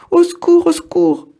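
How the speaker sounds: very high, loud cry for help, fading on the repeat; terrified scream. Acted. The apples scream for help as they fall, terrified. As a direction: scream for help while falling. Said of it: "hurlent Pépin et Trognon terrifiés".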